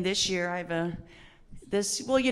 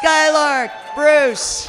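big, high, slow stretched name call; announcer call; 8 semitones higher, 11 dB louder, much slower than usual. Acted. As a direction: boom the name out to the room. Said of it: "so excited to bring to the stage".

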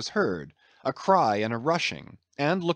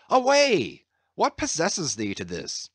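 angry shout; 4 semitones higher than usual. A narrator's reading. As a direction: bark it out to send him away. Starbuck angrily dismisses carpenter.

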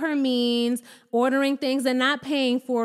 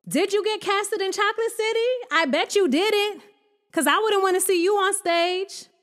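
mocking taunt; 8 semitones higher than usual. Real, not acted.

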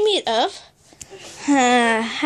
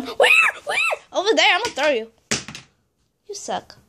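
ordinary talk

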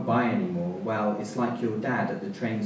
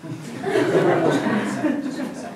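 laughing; 7 dB louder, much slower than usual. Real, not acted. Breaks up laughing before character.

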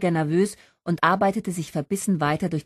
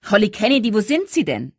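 loud, raised announcer call; booming moderator; 4 semitones higher, 6 dB louder than usual. A narrator's reading. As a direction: call out across the hall for a missing singer. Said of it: "dröhnte die Stimme des Moderators". (dröhnende Moderatorenstimme)

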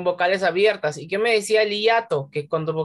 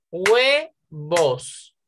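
HUEVOS as a long HUE, pause, VOS; two separate syllables. Shown as a demonstration. Teacher showing the class the diphthong, two separate syllables. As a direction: break huevos into HUE - VOS, keeping hue together. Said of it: "Solo hay dos sílabas".

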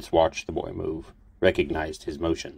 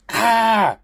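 sharp high yelp of pain, loud; pained scream; 8 dB louder than usual. Acted. Xander's toe is crushed by the staff, screaming. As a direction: cry out as the staff hits your toe. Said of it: "Xander screamed".

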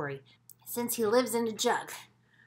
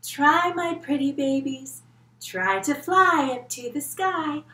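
slow, louder proud mama calling; louder mama bird; 6 semitones higher, 10 dB louder than usual. Acted. Kids join the teacher as mama bird, louder and slow. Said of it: "Can you try flying like Mama Blue Feather".

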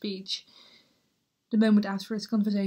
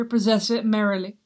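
grieving, bitter prince in torment; Hamlet soliloquy; 4 dB louder than usual. Acted. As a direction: swing between hushed despair and outbursts.

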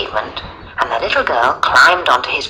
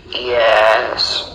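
low mumble, mouth barely open; mumbling lion; 4 semitones lower than usual. A narrator's reading. Lion answering the register warily, low mumble. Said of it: "He mumbled, opening his mouth as little as possible".

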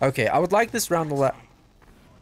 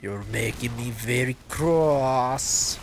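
mock Demo voice; much slower than usual. Gamer voices the Demoman scolding, slow and prim. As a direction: drawl it like a prim, offended Demo. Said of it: "says Demo".

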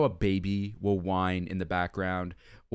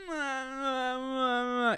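high drawn-out wailing sigh; mock wail; 13 semitones higher than usual. Vlogger mimicking the wailing instrument with a high wailing sigh. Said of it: "It's wailing".

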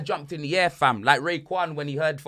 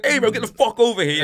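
mock angry summons; 6 semitones higher, 5 dB louder than usual. Friend angrily summons Abraham.

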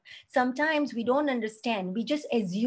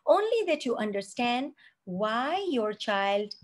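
normal talking voice

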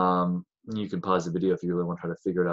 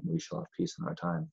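"We shall have peace in our time." said higher and softer; a light hint of Chamberlain, higher and softer; quoting Chamberlain's nickname; 8 semitones higher, 10 dB quieter than usual. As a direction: quote the famous line lightly, higher and softer. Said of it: "he has that lovely, uh, you know, nickname".

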